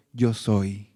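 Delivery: low, soft "Yo soy" as the Lord. Acted. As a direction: hush it down, calm and deep. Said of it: "la voz del Señor diciendo".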